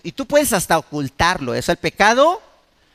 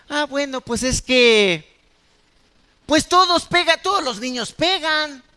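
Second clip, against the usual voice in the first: mock shrugging excuse; 5 semitones higher than usual. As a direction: shrug it off like an indulgent father. Parent excusing child hitting. (padre indulgente que resta importancia, en tono burlón)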